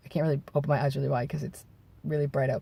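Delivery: ordinary talk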